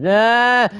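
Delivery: sudden high loud yelp of fright; scared shout in the middle of a game; startled yell. Real, not acted. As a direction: jump out of your skin and yell. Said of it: "I got scared".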